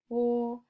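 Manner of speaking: "wo" high, level and held; first tone. Shown as a demonstration. Reading a first-tone example to students, high even "wo". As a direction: sustain "wo" on one high pitch. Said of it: "First tone".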